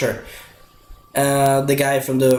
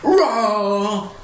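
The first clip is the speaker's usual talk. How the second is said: a higher mock dinosaur roar, far from his own voice; imitated T-Rex roar; 7 semitones higher than usual. Retelling the T-Rex answering the lion, a hammed-up roar. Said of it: "And the T-Rex like".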